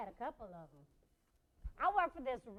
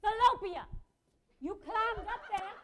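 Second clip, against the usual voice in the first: high, loud mother's call; calling to child; 8 semitones higher, 6 dB louder than usual. Acted. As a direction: call up to a kid on the playground. Mother calling to kid at park.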